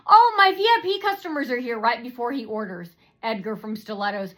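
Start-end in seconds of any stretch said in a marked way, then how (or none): 0.1-1.8 high, loud "oh" announcement; scripted announcement. Pretend. Waiter announcing VIPs before taking Edgar's order, loud interruption. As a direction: announce it pointedly, pitched up.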